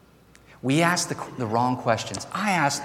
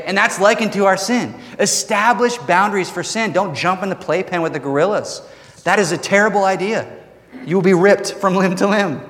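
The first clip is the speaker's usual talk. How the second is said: emphatic preaching; 6 semitones higher than usual. Acted. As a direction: drive the warning home to the church. Warning about sin boundaries.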